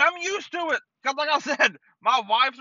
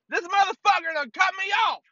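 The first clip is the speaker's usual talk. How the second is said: high-pitched indignant shouting; mock-furious complaint, pitched up; pissed off; 6 semitones higher than usual. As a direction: imitate a road-raging wife. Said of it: "Like, pissed off".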